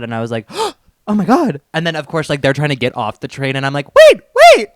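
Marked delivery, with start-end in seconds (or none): 0.5-1.6 excited gasp. Retold or imitated. Retold reaction to a fan. 3.9-4.7 much higher, louder call. Retold or imitated. Calling after a fan getting off the train.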